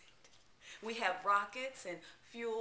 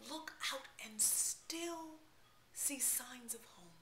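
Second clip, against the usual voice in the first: a soft whisper, fading out; hushed whisper; 3 semitones higher, 5 dB quieter than usual. Acted. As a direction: breathe the words like a memory of home. Poem on longing for home.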